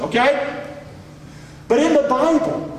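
ordinary delivery

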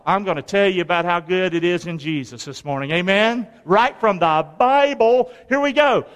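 ordinary talk

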